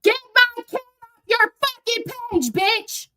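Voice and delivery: high, yelling sing-song fury; furious. Real, not acted. Mother yelling at Anna over her kid's post, furious high.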